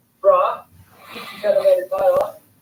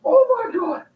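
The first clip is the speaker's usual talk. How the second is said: amazed; slower than usual. Real, not acted. Fish strikes the lure.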